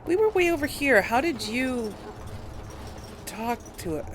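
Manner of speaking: ordinary talk, nothing marked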